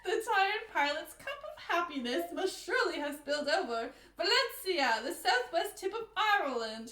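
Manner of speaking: theatrical mock-British, slipping Australian; botched British accent. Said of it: "I lost my British accent. I'm Australian".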